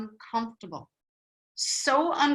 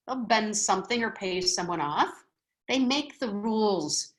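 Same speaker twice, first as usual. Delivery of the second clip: ordinary delivery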